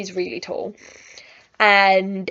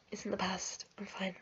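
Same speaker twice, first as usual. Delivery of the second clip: near-whisper, very soft; gone really quiet; 14 dB quieter, faster than usual. Real, not acted. Reassuring herself it's in the past, very quietly. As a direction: barely get the words out, whispering. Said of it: "my voice has gone really quiet".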